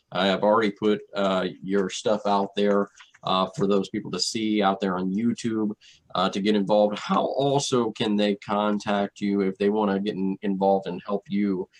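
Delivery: ordinary delivery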